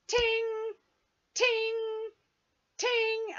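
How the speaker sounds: high sustained ting notes like piano keys; high held piano-note tings; imitating horror piano notes. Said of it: "horror movie piano key action thing".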